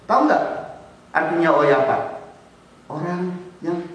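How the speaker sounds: ordinary talk, nothing marked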